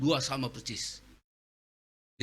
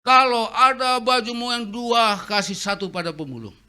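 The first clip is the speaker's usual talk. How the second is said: eager son explaining; 10 semitones higher, 11 dB louder than usual. Retold or imitated.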